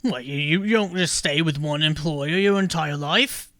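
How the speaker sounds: baffled toad asking about loyalty; toad folk voice. Acted.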